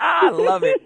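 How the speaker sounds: laughing high while speaking. Real, not acted. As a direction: laugh through the words. Laughing at the sexy voice.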